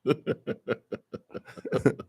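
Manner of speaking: long, soft, voiceless wheezing laugh; real breathy laughing fit; helpless laughter. Real, not acted. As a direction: crack up almost silently. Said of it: "I'm laughing".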